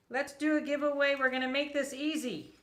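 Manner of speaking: high, loud host hype; excited announcement. Real, not acted. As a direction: pump up the viewers like a host. Announcing giveaway to live viewers.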